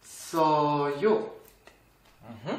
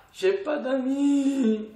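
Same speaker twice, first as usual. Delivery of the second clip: drawn-out, higher-pitched mock wail; mock lament; 7 semitones higher, much slower than usual. Teacher giving the worse example of having no friends, mock lament. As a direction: whine it slowly in pretend self-pity.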